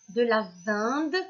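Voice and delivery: VINDE drawn out with the nasal in sound; wrong-word option. Shown as a demonstration. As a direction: slowly offer VINDE with a nasal in. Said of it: "on dit de la viande". (« vinde » étiré lentement, avec le son « in »)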